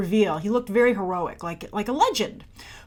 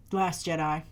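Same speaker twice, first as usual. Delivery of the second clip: ordinary delivery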